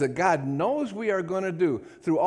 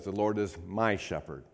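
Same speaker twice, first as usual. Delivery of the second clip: quiet reading; 8 semitones lower, 6 dB quieter than usual. Shown as a demonstration. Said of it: "Notice that, how he says that".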